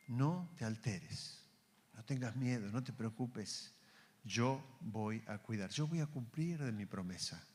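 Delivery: calm their fear slowly; soothing reassurance. Acted. God calming a frightened believer, slow and soft.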